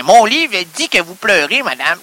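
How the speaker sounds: ordinary delivery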